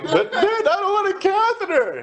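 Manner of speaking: put-on high, childish pleading whine; telling the boss, pleading like a scared child; whiny plea.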